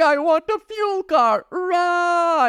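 very high, drawn-out imitated wail; mock crying child. Dad imitating his daughter woken in the car, mock crying. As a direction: wail like a toddler, hammed up. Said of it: "she starts crying".

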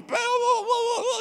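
high, loud wailing blubber; mock sobbing wail. Grandpa imitating the whole family sobbing, loud wail. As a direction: wail it like everyone's sobbing at once. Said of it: "We're all sobbing".

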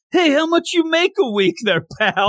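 mock wise guy. Mock tough guy jab at Rakugo etiquette.

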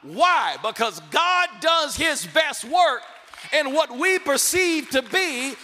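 loud, driving sermon cadence; full-force preaching. Acted. Pastor voicing God to Gideon, preaching at full force. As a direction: preach it hard to the crowd.

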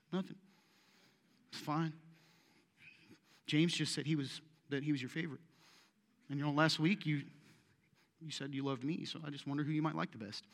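soft, hesitant, acted sulk; mock sulky disciple. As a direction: play hurt and mumble it softly. Acting a hurt disciple answering Jesus.

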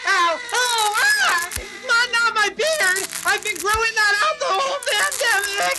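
high, panicked cries of pain; high whiny wail; pained yelping. Acted. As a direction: yelp as the cat claws you. Being scratched by cat.

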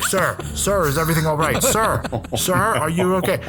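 high, concerned call of sir, sir; concerned lifeguard. Retold or imitated. Lifeguards calling to the boy over the wall, high and worried. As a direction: imitate a worried lifeguard checking on a man, high. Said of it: "And they're like".

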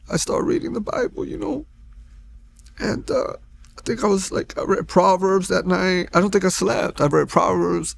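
real high, catching voice; tearful, voice thinning upward as you remember; choked up. Real, not acted. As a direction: push on through rising tears. Choked up recalling first Bible reading.

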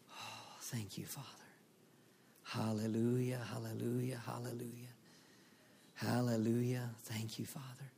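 soft whispered worship; whispered prayer. Real, not acted. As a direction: murmur praise under breath.

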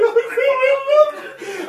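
mock tender falsetto with a laugh; tender and playful. Preacher playfully mocking his own tender plea to God, high falsetto. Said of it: "I'm tender".

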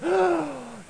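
high, drawn-out mock gasp; acted gasp. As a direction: suck in air in staged shock. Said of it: "I hear all of a sudden this".

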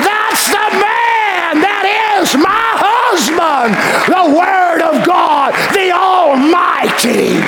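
high, slow, ringing proclamation; rapturous shout. Pretend. The bride praising her husband before all, high rapturous shout. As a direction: proclaim him with rising joy, slowly. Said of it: "looking at him and saying".

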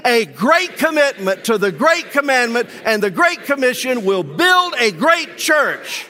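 with all your heart. Real, not acted. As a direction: proclaim it like you really mean it. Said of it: "Say it with all of your heart".

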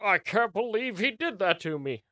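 a hint of an old man in the voice.

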